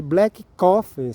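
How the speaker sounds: slow, careful English, each word laid out; slow English demo. Shown as a demonstration. Vlogger showing viewers simple English, slowly. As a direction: spell it out slowly for learners. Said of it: "Você vai falar".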